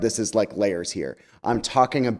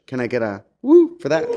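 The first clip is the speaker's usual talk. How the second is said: louder, rallying call for a cheer; hyping the crowd; 5 dB louder than usual. Real, not acted. Speaker rallies the church audience for a cheer, hyped. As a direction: pump up the audience for a woo. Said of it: "asking the crowd for a cheer".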